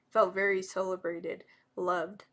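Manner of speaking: ordinary delivery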